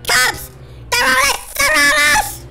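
high panicked yell; panicked cry. Real, not acted. Gamer fleeing police, high panicked cry. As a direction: shriek that they're being chased. Cops chasing them.

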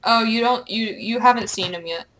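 ordinary talk, nothing marked

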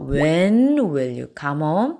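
very slow, word by word; slow model sentence. Shown as a demonstration. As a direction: read it slowly for the class. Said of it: "집에 언제 들어올까요?". (아주 천천히 예문 읽기)

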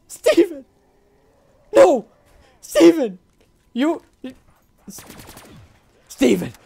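loud high shout calling Steven; yelling a name. Real, not acted. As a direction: shout his name like he's lost. Calling out for lost zombie.

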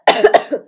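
loud sick cough; harsh cough from illness; real cough. Real, not acted. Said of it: "anxiety-inducing coughs".

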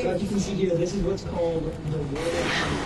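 soft, hushed documentary voiceover; documentary narrator. Acted. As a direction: narrate like a nature show host.

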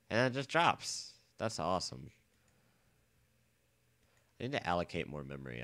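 ordinary delivery

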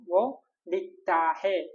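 വോ ലിഖ്താ ഹേ said slowly, every syllable drawn out; slow model phrase. Shown as a demonstration. Teacher modelling the Hindi for he writes, slow. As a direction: stretch out വോ ലിഖ്താ ഹേ for learners. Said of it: "ഇനി അവൻ എഴുതുന്നു".

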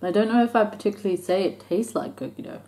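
slightly chewing while speaking; talking with food at lips. Real, not acted. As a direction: hand against mouth. Seen 3: Hand holds small food piece against lips while talking, likely chewing.

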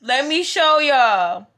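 loud, high excited shouting with laughter; shook. Real, not acted. Vlogger shouting about being on Jackie's story. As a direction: yell the news in disbelief. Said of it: "I'm literally shook".

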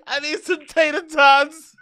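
high, slow words stretched through laughter; laughing. Real, not acted. Mocking a fan's order, laughing hard. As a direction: giggle out the order.

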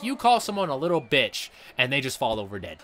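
normal talking voice

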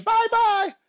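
very high, loud shriek; top-of-lungs toddler yell. A narrator's reading. Polly yelling as brother slips out the door, shrieked. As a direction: yell it at full pitch like a little kid. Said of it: "at the top of her lungs".

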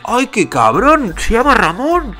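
high, slow delighted exclamation; gleeful outrage. Real, not acted. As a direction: cry out in amused disbelief. Delighted outrage at [name]'s name.

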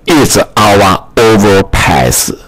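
ordinary delivery

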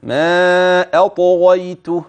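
slow recitation of أطغيته, thick bouncing ط and غ, then light ي and ت; heavy ṭāʾ, light ending. Shown as a demonstration. Tajweed teacher demonstrating heavy ط then light ت in أطغيته, slowly. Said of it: "وتفخم الطاء وتقلقلها أيضًا".

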